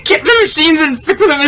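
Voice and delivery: words squeezed high through laughter; real, helpless laughter in the voice; laughing hard. Real, not acted. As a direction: crack up while telling it. Cracking up at own story.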